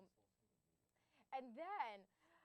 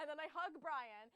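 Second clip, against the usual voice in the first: usual speaking voice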